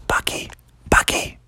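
hushed, secretive calling; stage-whispered name. Acted. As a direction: whisper to get his attention. Calling softly past sleeping dragon.

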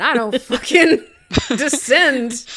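slow loud laugh-broken declaration; drawn out and loud through a laugh; laughing proclamation. Acted.

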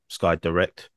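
ordinary talk, nothing marked

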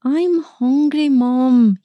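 put-on slow, high boyish whine; protesting. A narrator's reading. Boy refusing to go and wash, whining protest. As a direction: drag it out as a child's complaint. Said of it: "he protested".

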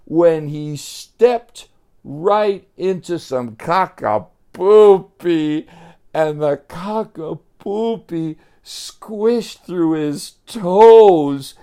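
slow, high and loud, vowels stretched; gleeful gross-out. A narrator's reading. Storyteller relishing Joey's squishy step, loud drawn-out telling. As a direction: draw out every squishy word, building to a big finish.

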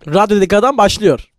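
loud, projected announcer call; mock radio announcement. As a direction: announce it big like a radio host. Radyo anonsunu taklit ediyor. (yüksek sesle radyo anonsu)

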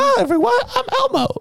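very high puppet falsetto; Elmo voice. Pretend. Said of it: "you think Elmo was Southern".

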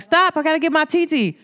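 put-on high, urgent kid voice, rushed at the end; a boy begging the teacher to wait; little boy's plea. Nephew tells his teacher to stop, high and urgent.

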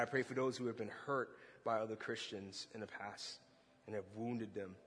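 low, soft, steady prayer voice. Real, not acted. Preacher praying over the congregation, soft and low.

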